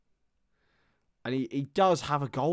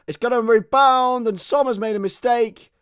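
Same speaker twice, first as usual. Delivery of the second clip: a sudden, higher, louder burst as the keeper fumbles; excited; 9 semitones higher, 10 dB louder than usual. Real, not acted. Streamer reacting to the keeper's mistake, excited shout. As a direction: yell it out as the ball spills loose.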